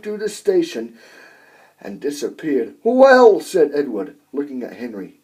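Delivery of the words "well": high, loud amazed well; astonished well. A narrator's reading. Edward reacting after Gordon screams past, astonished. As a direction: gasp it out in amazement.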